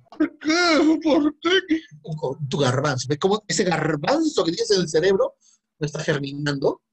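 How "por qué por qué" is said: high, loud why; real exasperated outcry; despairing cry. Real, not acted. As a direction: wail at the class. Teacher despairs at wrong answer.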